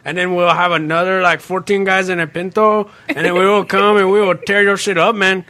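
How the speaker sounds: put-on comic Mexican-accented rebel; put-on Mexican accent, rambling threat. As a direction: make battle plans in a mock Mexican accent. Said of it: "best accents are Mexican and French".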